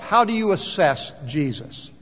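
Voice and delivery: ordinary talk, nothing marked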